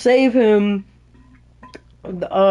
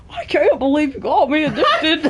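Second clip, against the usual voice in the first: higher, hammed-up voice of a betrayed addict; Eddie Brock; 7 semitones higher than usual. Friend repeats the accusation after being teased, higher and hammed-up. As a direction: exaggerate the character, swinging the pitch up through laughter. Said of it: "Why does he sound like Eddie Brock?".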